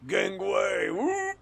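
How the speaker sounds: loud, high-pitched comic whoop; mock battle call. Acting out a comic panel.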